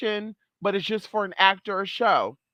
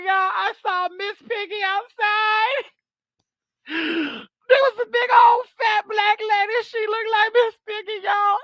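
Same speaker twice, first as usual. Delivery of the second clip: high, loud fake-crying YouTuber; mock breakdown; 13 semitones higher, 3 dB louder than usual. Vlogger imitates a YouTuber's staged breakdown, high fake wail. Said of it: "while Mama's having this emotional breakdown".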